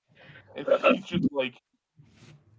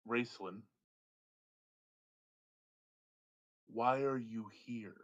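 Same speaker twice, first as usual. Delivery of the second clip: quiet wizard; 3 semitones lower, 9 dB quieter than usual. Acted. Wizard knows his name.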